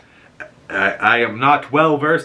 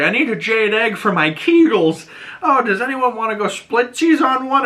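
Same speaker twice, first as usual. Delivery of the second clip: high sing-song put-on voice; mock Agatha; 11 semitones higher than usual. Agatha asks shoppers to split an egg, high sing-song. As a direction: ham up a high sweet voice.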